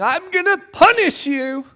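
hammed-up divine threat; mock angry God. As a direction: play a reactive, punishing God. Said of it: "this is not God going".

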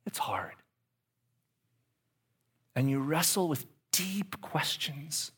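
breathy confiding whisper. Real, not acted. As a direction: lean in and whisper it. Intimate confession to audience.

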